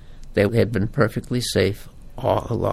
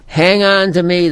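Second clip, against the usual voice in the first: shouted higher and louder, urgent; father shouting; 6 semitones higher, 6 dB louder than usual. Acted. Father in the water shouting to his children, urgent. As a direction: call out to hold the drowning kids. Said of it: "the daddy shouted".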